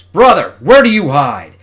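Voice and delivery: loud, high call across the battlefield. Acted. Searching for her brother, she calls out loudly. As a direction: shout for him to show himself.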